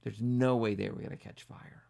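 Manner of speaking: ordinary delivery, nothing marked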